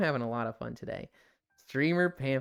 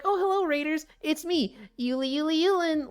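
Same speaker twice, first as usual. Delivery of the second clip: very high, bouncy streamer greeting; exaggerated intro voice; 12 semitones higher than usual. Shown as a demonstration. Streamer greeting raiders with a practiced intro, exaggerated high voice. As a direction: overdo a cutesy, high-pitched hello. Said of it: "if I had a very exaggerated way of talking".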